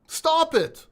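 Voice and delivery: loud, high-pitched stop it; firm stop. Real, not acted. Telling viewers to stop buying dead coins, loud. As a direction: shut it down like a fed-up coach.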